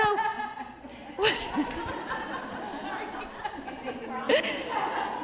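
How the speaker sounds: high, drawn-out laugh; long laugh. Real, not acted. Coach laughing after the roleplay, long laugh. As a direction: laugh it off at length.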